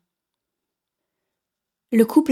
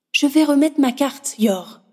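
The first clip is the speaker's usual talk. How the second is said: higher, fed-up wife; wife's voice; 5 semitones higher than usual. A narrator's reading. Wife leaving her husband, higher fed-up voice. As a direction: sigh it out, disgusted. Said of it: "rétorqua l'autre".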